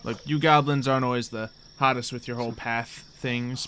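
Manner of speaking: normal talking voice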